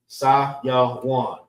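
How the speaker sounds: Sayawan in three even beats, SAH-YAH-WAHN; syllable by syllable. Shown as a demonstration. Teacher showing three syllables, Sayawan broken into beats. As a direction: separate each syllable of Sayawan. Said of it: "Three syllables".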